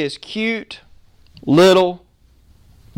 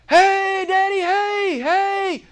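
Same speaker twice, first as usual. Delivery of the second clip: put-on high, excited child calling out to his daddy; little boy yelling; 12 semitones higher, much slower than usual. Little boy at the race calls to his father, high yelling. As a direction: yell for daddy like a small boy. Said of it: "Just yelling, yelling, screaming".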